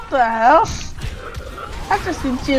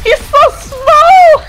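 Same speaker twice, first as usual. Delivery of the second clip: high, loud, drawn-out cooing; delighted squeal; 11 semitones higher, 8 dB louder, much slower than usual. Real, not acted. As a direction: squeal over something tiny, stretching it out. Cooing over a tiny game character.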